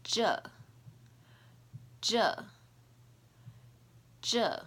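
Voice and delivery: slow, clear teacher demo of "zhe"; Mandarin zhe. Shown as a demonstration. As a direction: say "zhe" with a retroflex zh. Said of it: "The sound you are hopefully familiar with is the zh".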